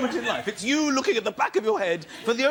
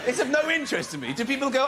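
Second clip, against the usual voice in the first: normal talking voice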